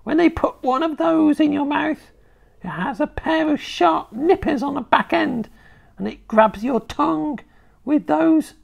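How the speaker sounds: put-on high, cackling old hag; old witch voice. A narrator's reading. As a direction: play the nasty old grandma. Grandma witch voice in story.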